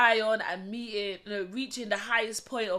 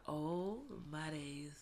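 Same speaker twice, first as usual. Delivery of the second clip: softer, whispered in wonder; awed whisper; 6 semitones lower, 14 dB quieter, much slower than usual. Retold or imitated. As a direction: breathe it out, overwhelmed. Melting on hearing the song.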